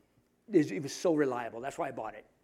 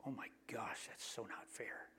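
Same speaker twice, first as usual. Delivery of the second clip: much softer, whispered sympathy; hushed dismay; 6 semitones lower, 20 dB quieter than usual. Retold or imitated. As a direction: breathe it out in shocked whisper. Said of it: "I hear stories and I go".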